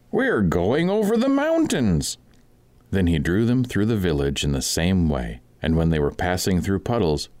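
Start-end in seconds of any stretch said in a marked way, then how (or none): 0.1-2.2 high, ringing call to the fools; high mocking cry to the two in the sack; mock-solemn cry. A narrator's reading. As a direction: cry out high and grand as if on a journey. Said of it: "he cried".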